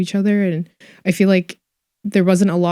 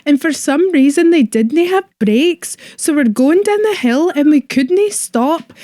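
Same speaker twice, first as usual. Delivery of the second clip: put-on a Glasgow friend's story in a higher, broad Scottish accent; strong Scottish accent; 8 semitones higher, 3 dB louder than usual. Asked by the host, retells the Glasgow friends' bike ride in a thick Scottish accent. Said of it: "can we hear you dial your Scottish accent up to eleven".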